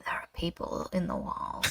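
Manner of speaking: hushed, secretive whisper of a haunted cousin; eerie whisper. Retold or imitated. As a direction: whisper it like a creepy confession. Cousin's eerie aside after reassurance.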